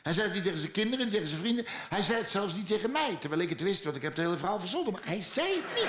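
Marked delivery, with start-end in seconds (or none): 4.9-5.9 laughing. Real, not acted. Laughs at own invented story.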